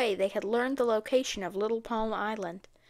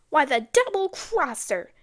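a louder, higher outburst of anger; furious cry; 5 semitones higher, 5 dB louder than usual. A narrator's reading. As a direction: spit out the betrayal, raised and furious. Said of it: "the woman cried furiously".

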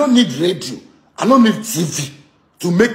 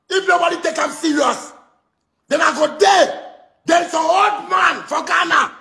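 impassioned rant; 6 semitones higher than usual. Real, not acted. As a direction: swear it at the top of your voice, stretching the words. Heated political rant, swearing to God.

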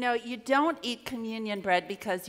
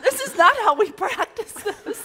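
cracking up mid-line; can't stop laughing; 12 semitones higher than usual. Real, not acted. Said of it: "this is way off script".

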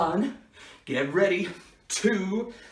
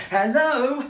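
raised, loud hello into a phone; phone hail; 6 semitones higher, 6 dB louder than usual. Pretend. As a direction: call out to see if anyone answers. Calling friend on bad line.

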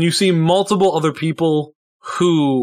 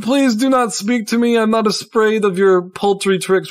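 haughty insistence; 7 semitones higher than usual. Acted. Character repeats his haughty dismissal.

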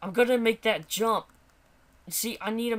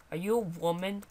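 ordinary talk

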